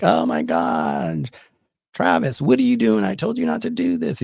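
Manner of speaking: high-pitched mock scolding of the dog; scolding owner. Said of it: "all of a sudden you're like".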